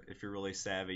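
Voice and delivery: ordinary talk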